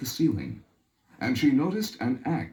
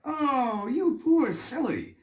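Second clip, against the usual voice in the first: much higher, slow pitying voice; mocking Hans; 10 semitones higher than usual. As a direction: pity her silliness aloud. Hans scolds the woman.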